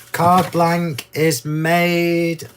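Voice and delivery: very slow, drawn out. Real, not acted. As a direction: stretch every word. Drawn-out explanation.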